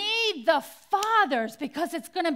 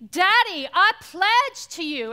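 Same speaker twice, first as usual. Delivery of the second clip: attempted Southern drawl, raised and loud; 5 semitones higher, 8 dB louder than usual. Daughter pledging to her late father, imitated Southern drawl. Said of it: "in her thick Southern drawl".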